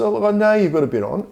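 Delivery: mock knowing reply. Mocking reply to Greenie.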